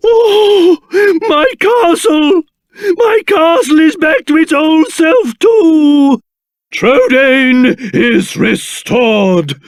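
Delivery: gasp, then high-pitched elation; amazed delight. Acted. King sees his castle restored, amazed and delighted. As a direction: marvel aloud, thrilled.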